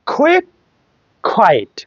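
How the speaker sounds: কুইক and কোয়াইট with Q like খ; aspirated K. Shown as a demonstration. Teacher drilling Q words, aspirated কুইক, কোয়াইট. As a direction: puff into each of কুইক, কোয়াইট. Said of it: "খর মতো উচ্চারণ হবে".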